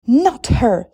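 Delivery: loud furious protest; second stepsister screaming her objection. A narrator's reading. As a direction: scream it in refusal. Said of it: "screamed the other stepsister".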